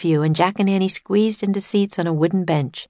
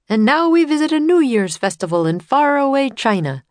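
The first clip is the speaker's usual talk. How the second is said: higher, louder showman announcer; tour guide announcer; 8 semitones higher, 5 dB louder than usual. Acted. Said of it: "said the guide".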